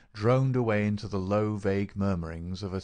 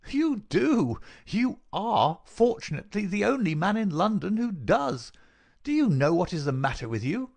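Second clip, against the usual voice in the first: high, loud, sneering visitor's voice; sniggering scorn; 11 semitones higher, 5 dB louder than usual. A narrator's reading. Visitor boasts to the sick man, sneering. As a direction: crow over him with mocking relish. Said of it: "Our visitor sniggered".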